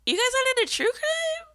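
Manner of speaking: high-pitched shocked self; incredulous squeal. Retold or imitated. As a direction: squeal it like you can't believe it. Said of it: "And I'm like".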